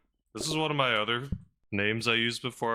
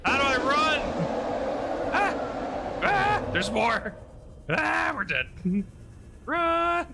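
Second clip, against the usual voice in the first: high, panicked yelps and gibbering; terrified gibbering; 10 semitones higher than usual. Real, not acted. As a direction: panic as if being chased. Panicking during monster attack.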